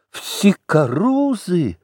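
captain crying out, baffled by the doctor; bewildered cry. A narrator's reading. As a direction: cry out, not understanding. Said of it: "вскричал штабс-капитан".

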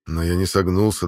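plain reading voice

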